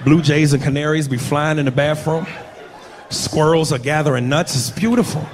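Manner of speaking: ordinary talk, nothing marked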